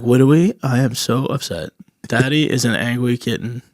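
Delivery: put-on quiet, babyish w-for-r voice; softly mock-sulking oddball; comic put-on accent. Upset customer to the waiter, soft comic babyish accent. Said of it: "I've been saying that accent all weekend".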